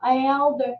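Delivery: normal talking voice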